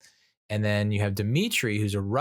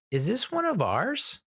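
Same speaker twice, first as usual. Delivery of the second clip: much higher-pitched mock-innocent query; innocent puzzled question; 12 semitones higher than usual. Clementine asking Winston about the grenade, high puzzled question. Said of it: "turns to Winston and goes".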